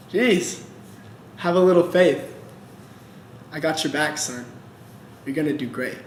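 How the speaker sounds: low, soft and reassuring as God. Acted. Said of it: "He goes".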